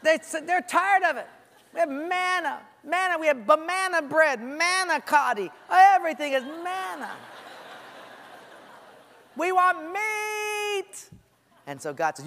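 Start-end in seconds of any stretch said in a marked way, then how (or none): 0.0-11.1 griping whine. Retold or imitated. Israelites fed up with manna, whining for meat.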